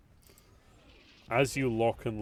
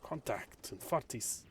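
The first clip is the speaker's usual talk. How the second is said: put-on slipping German accent; 4 semitones higher, 5 dB quieter than usual. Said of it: "I'm slipping it".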